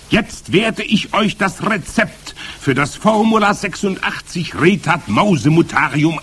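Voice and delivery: high witch announcement; imperious high witch voice; calling out. A narrator's reading. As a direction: proclaim it to the assembled witches. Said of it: "rief die Hoch".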